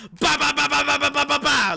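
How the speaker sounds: loud, high, rapid babbling imitation; mock babble. Coworkers mimicking the ADHD boss's rapid babble. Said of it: "they'd be like".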